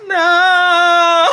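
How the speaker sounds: high, loud, drawn-out wail; hammed-up denial breaking into laughter; long whiny howl of protest; mock crying. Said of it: "I am crying".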